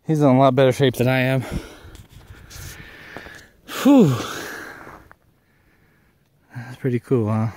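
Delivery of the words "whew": loud relieved exhale after the climb; exhausted whew. Real, not acted. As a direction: blow out a tired whew. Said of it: "I'm out of breath".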